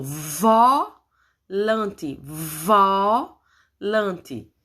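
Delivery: "volante" with a long vooo, pause, then lante; syllable by syllable. Shown as a demonstration. Teacher introduces the last drawing's word, slow drawn-out syllables. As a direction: stretch the first syllable of "volante".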